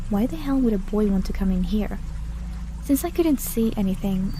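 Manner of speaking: plain reading voice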